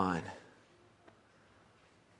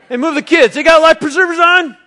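calling out to wife; 14 dB louder than usual. Pretend. Husband calls out at the pool.

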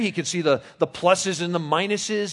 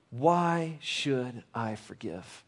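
slow hushed repeat; 4 semitones lower, 7 dB quieter, much slower than usual. Shown as a demonstration. Repeats question slow and soft.

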